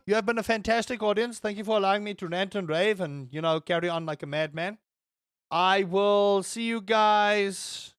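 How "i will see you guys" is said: very slow, stretched sign-off; drawn-out farewell. Real, not acted. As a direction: linger on each word. Signing off to audience.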